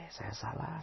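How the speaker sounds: a hint of a contrite wife; hushed admission. Wife admits fault, first take.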